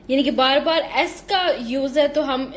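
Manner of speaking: ordinary delivery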